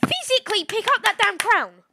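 high loud exasperated order. Real, not acted. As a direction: yell it in frustration. Frustrated at teammate.

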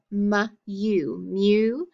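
"mu" as m alone, then long-U "mew"; sounded out. Shown as a demonstration. Teacher demonstrating the long U, sounded out. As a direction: blend "mu" into a drawn "mew". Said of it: "make it a long U".

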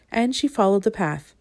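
usual speaking voice

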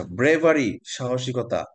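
ordinary delivery

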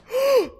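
sharp, high shocked gasp; exaggerated gasp; loud gasp. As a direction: suck in air dramatically. Gasp after reading racing chest.

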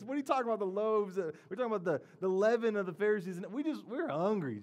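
high, loud, comically baffled protest; mock whining. Disciples interrupt Jesus about loaves and leaven, mock whining. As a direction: whine over Jesus like hungry, confused disciples. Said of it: "what are they thinking about?".

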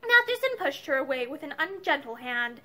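plain reading voice

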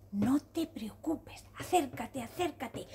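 soft, half-whispered, slow comfort; soothing reassurance. Acted. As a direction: coax the little zebra closer, hushed. Snail reassures the crying zebra.

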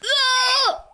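high startled yell. Real, not acted. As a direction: cry out in shock as you fall. Sudden fall in game.

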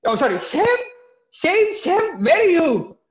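high shouting call. Acted. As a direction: yell across the ship for Shem. Calling for his brother. (brother shouting for Shem)

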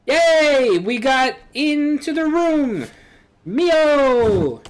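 high, loud, drawn-out cheering; overjoyed cheer. Acted. Game girl celebrating getting into the room, jubilant shout. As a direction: cheer and call out to your friend.

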